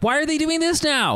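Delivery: high-pitched incredulous whine; exasperated complaint. Retold or imitated. Recounting own exasperated reaction to scene.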